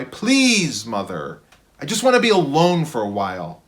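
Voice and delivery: put-on teen barks at her robot mother to be left alone; barked. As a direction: bark it up at the ceiling, fed up. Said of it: "Eva barked at the ceiling".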